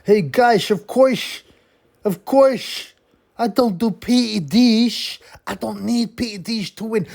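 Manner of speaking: high, slushy hammed-up Swedish accent; mock Swedish accent. Boxer protesting he is clean of PEDs, mock Swedish accent. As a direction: caricature a naive Swede in a high, earnest Swedish accent. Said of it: "very naive Swedish guy ... seems to speak in a, a Swedish accent".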